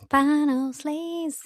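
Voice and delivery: slow, drawn-out announcer call of the segment name; hammed-up jingle-style announcement. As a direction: announce it like a show title.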